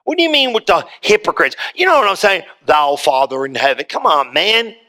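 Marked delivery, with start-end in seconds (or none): 2.7-3.9 stiff formal prayer. Pretend. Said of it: "I don't talk like that".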